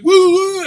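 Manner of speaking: high, loud taunting whoops; imitated whooping. Drunk guy fleeing the opened door, mock whooping. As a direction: whoop like a drunk prankster running off.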